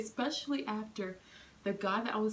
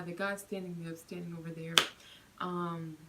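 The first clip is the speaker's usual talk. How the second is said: ordinary talk, nothing marked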